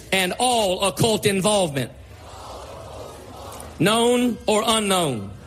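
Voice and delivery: slow, emphatic preacher's declaration; slow renunciation prayer. Acted. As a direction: declare each phrase slowly with pauses. Leads congregation in renunciation prayer.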